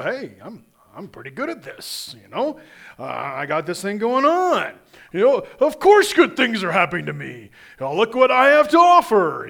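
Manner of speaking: loud, hammed-up bragging; over the top cocky. Preacher mocks a proud believer, hammed-up cocky boasting. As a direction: puff yourself up, brag it big. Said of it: "of course I'm being over the top".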